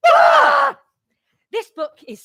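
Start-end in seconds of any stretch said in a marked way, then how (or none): 0.1-0.8 high, loud scream; mock-terror scream. Reacting to a werewolf in the tent, high scream. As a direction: scream as if terrified.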